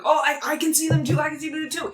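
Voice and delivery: high, quick, frantic soldier voice; alarmed soldiers. Acted. Game master voicing soldiers who spot figures, alarmed. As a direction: blurt it out high, like a rattled lookout. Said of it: "you see some of them go like".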